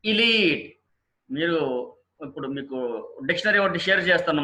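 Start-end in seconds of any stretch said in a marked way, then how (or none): 0.0-0.8 ఎలీట్ said "ee-LEET"; drawn-out ee ending on ఎలీట్; correct pronunciation. Shown as a demonstration. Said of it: "ఎలైట్ కాదండి".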